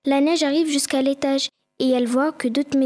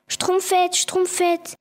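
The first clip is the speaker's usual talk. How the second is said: mild panicked call, higher; half-shouted call up the stairs; 5 semitones higher, much slower than usual. Acted. Schtroumpf Coquet running upstairs to wake his friend, urgent calling. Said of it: "en hurlant".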